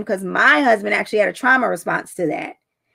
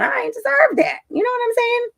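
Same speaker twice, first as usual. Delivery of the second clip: high emphatic aside. Real, not acted.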